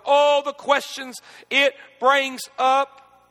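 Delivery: rising, pressing preacher's cadence, loud and high; preaching at full force. Acted. Preacher pressing grieving congregation with hard questions, full force. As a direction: hammer each question home from the pulpit.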